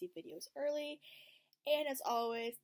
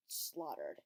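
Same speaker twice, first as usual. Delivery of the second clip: ordinary talk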